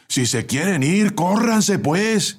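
high-pitched call to a crowd; shouting. A narrator's reading. Bus helper outside telling passengers to move, shouting. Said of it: "gritó el ayudante desde afuera".

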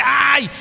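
high, loud yelp; sudden demonstrated cry of pain. Shown as a demonstration. Preacher shows how someone in need cries out, yelping.

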